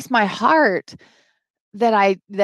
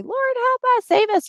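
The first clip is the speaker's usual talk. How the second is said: much higher pleading to God; pleading cry. Retold or imitated. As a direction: beg the Lord for rescue. Crying out to the Lord.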